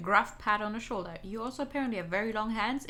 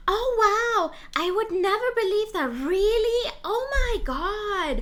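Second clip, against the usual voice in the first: loud, high, gushing fake wonder; exaggerated sarcastic awe, drawn out at the end; sarcastic amazement; 11 semitones higher, 6 dB louder, slower than usual. Shown as a demonstration. Streamer showing how sarcasm sounds in the voice, sarcastic amazement. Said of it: "it's mostly in the tone of the voice".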